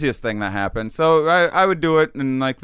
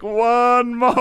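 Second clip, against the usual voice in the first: high, louder urging voice; friend pushing; 12 semitones higher, 6 dB louder than usual. Retold or imitated. Friend urging one more hit, high and loud. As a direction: egg him on, much higher and louder.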